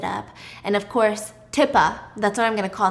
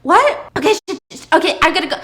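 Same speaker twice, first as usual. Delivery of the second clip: flustered panic; 5 semitones higher, 6 dB louder than usual. Retold or imitated. Husband flustered, told to go.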